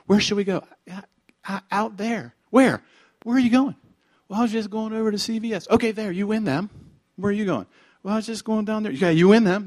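playful back-and-forth. Acted. Acted disciples and Jesus exchange.

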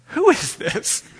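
high, slow, drawn-out puzzled question. Retold or imitated. Old professor on the phone, puzzled by a late caller. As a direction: sound baffled by a stranger on the line.

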